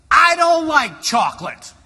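loud, high mock-angry nurse voice; cross nurse. Second nurse confronting the patient over his bribe, cross. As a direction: burst in loudly with a high-pitched objection. Next nurse storms in objecting.

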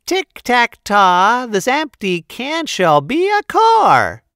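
very high, loud magic spell chant; tiny tinkling fairy voice. Acted. Fairy casting a rhyming spell.

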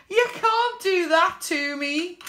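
high, lightly voiced complaining viewer; a hint of a whiny viewer pleading; mock viewer protest. Imagined viewer pleading with the host, mild mock whine. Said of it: "I hear you shout".

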